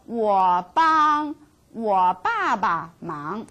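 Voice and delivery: 我帮我爸爸忙 said slowly and evenly; slow model sentence. Shown as a demonstration. Teacher models the whole sentence slowly. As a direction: read 我帮我爸爸忙 slowly for the class. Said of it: "let's go to this simple one".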